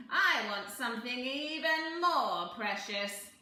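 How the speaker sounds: a lightly voiced scheming little man, slow and loud; sly little man. A narrator's reading. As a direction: bargain like a crafty imp.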